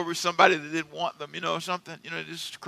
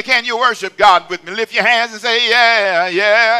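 loud, higher call to worship; preaching at full force; 6 semitones higher, 10 dB louder than usual. Retold or imitated. Preacher retelling calling a funeral crowd to worship, loud. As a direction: call the crowd to lift their hands, loud. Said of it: "So I say".

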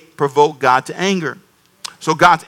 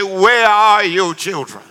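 slow, lightly voiced commercial announcer; drawn-out warning from the old ad; slower than usual. Pastor recalling the commercial's voice, slow and ominous. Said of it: "it would say".

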